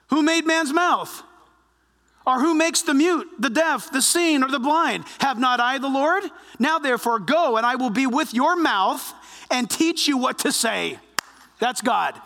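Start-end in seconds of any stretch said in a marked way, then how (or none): none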